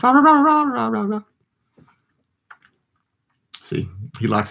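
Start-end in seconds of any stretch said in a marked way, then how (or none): 0.0-1.2 high excited "rah rah rah" chant to the dog; rah rah dog talk. Real, not acted. Cooing at the dog.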